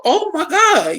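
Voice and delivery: put-on thrilled old lady crying out; excited grandma.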